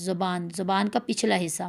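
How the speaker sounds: usual speaking voice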